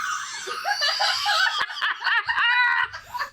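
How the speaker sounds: high shrieking burst of laughter. Real, not acted. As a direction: lose it completely, squealing. Cracking up at edited photo.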